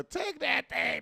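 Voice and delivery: high-pitched mock woman urging. Woman in the crowd telling him to take it, high mock voice. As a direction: push him like the lady in the crowd. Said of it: "look at old girl".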